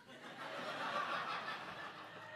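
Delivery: breathless silent laugh. Real, not acted. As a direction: laugh at the memory. Laughing at the story.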